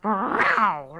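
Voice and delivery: loud, vexed meow; angry cat meow. Acted. Cat vexed by the snow, loud angry meow. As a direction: let out a cross cat's meow. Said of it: "bounced in with an angry".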